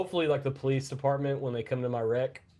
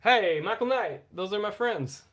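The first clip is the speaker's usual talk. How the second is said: high, louder playful shout-out; high excited call; 9 semitones higher, 4 dB louder than usual. Pretend. Mock calling out to viewer.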